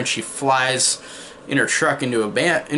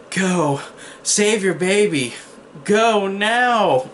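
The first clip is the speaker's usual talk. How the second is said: stiff, overacted B-movie delivery, pitched higher; bad line read; 7 semitones higher, much slower than usual. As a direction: ham it up like a wooden actress. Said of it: "in this really bad, uh, line read".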